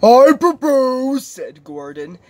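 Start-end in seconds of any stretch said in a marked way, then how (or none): 0.0-1.3 loud, slow, high-pitched proclamation; pompous proposer. Acted. Big engine proposing to the others, pompous and loud. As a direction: announce it grandly and draw it out. Gordon makes a formal proposal.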